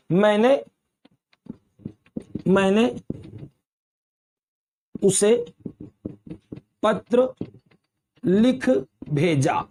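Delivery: slow dictation, long pause after each word; dictating. Shown as a demonstration. Teacher writing a second example for students, dictating. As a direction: spell it out word by word as you write.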